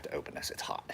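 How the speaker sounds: breathy aside to himself; whispering. Real, not acted. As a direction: whisper it under your breath. Muttering to himself while sweating.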